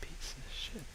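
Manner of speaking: under-breath whispered swear; whispered curse. Real, not acted. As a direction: hiss it under your breath. Cursing at the phone menu.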